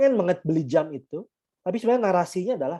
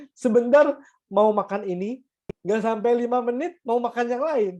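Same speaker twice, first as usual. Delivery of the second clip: higher, louder teasing wife voice; mock the wife's teasing, pitched up; imitating wife; 7 semitones higher, 4 dB louder than usual. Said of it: "Jadi istri saya bilang". (menirukan istri yang menyindir, lebih tinggi dan keras)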